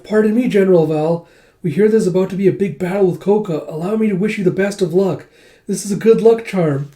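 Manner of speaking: sweetly gushing admirer, hammed-up; giddy admirer. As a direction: pitch it up as an eager fangirl. Giddy admirer wishes general luck.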